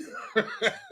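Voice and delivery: a shrill burst of real laughter; squealing real laugh; high squeal of laughter. Real, not acted. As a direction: crack up high after the joke. Laughs at own Tin Man bit.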